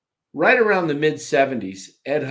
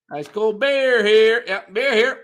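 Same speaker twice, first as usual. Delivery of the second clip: higher, slow beer vendor call; stadium vendor hawking; 9 semitones higher, 5 dB louder than usual. Retold or imitated. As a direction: holler it like a beer man. Vendor imitation.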